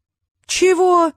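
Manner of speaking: high, loud, drawn-out yelp; outraged yell. A narrator's reading. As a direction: cry out in stunned disbelief. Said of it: "завопил Трикс".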